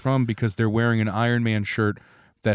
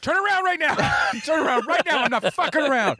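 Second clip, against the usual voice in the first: shouted command. Retold or imitated. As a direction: yell at the kid to turn around. Yelling at own child.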